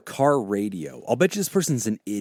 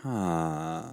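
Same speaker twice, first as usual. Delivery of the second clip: ordinary delivery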